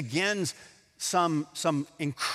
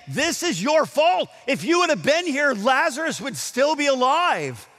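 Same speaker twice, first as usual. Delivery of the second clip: accusing; 9 semitones higher, 5 dB louder than usual. Retold or imitated. Voicing Mary's blame of Jesus.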